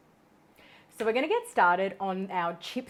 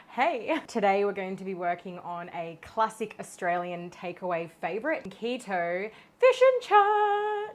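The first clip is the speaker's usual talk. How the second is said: normal talking voice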